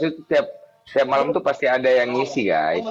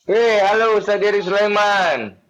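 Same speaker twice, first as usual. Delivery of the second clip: calling out; 8 semitones higher, 3 dB louder than usual. Real, not acted. Loud greeting to viewer.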